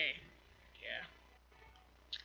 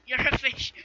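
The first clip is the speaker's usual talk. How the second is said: laughing loud disbelief; 5 semitones higher, 11 dB louder than usual. Real, not acted. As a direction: burst out through a laugh. Laughing at the reveal.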